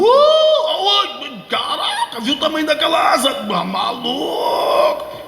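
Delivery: giddy stoned voice with laughs. Pretend. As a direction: rave high and laughing, tripping. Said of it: "todo mundo dopadinho".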